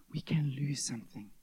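whispered, breathy with no voice; hushed warning. Acted. Pastor voicing Jesus to the congregation, whispered.

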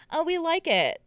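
high, chirpy editor voice; mock editor voice. Editors praising her story, high and chirpy.

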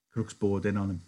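ordinary talk

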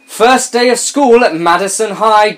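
high, slow, hammed-up read; mock story reading. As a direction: read it like an overexcited teen narrator. Mock reading of teen fan fiction.

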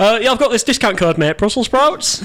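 voiced customer. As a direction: act out a hopeful caller, rising at the end. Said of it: "I'd love it if you just got".

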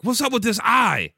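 high, loud demanding yell; frantic shout. Acted. Patient confronting a doctor in the parking lot, frantic shout. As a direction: shout it in hammed-up alarm.

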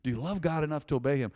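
ordinary talk

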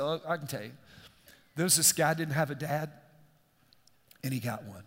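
low, subdued, halting with emotion; choked-up retelling. Retold or imitated. Husband finally telling wife about the movie, choked up.

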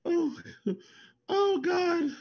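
normal talking voice

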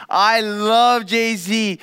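lightly imitated fan delight; gushing love, again. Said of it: "his conclusion at the end of that all was".

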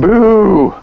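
loud, high-pitched boo; playful scare. Pretend. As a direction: jump out with a mock scare. Trying to scare the seller in improv.

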